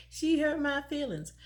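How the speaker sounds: laughing through the words; amused at her younger self. Retold or imitated. Repeats quote laughing at herself.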